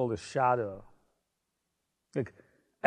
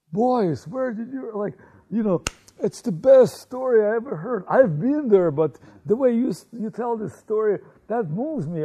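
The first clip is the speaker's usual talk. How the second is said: high, loud, tearful-sounding praise; voicing a crowd overwhelmed by the singing; moved, excited praise; 8 semitones higher, 8 dB louder, faster than usual. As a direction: gush over the boys like a moved elder. Crowd moved to tears praising boys.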